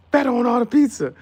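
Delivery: loud, high excited yell; shouted joke. Real, not acted. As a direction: yell it out gleefully. Yelling feta joke.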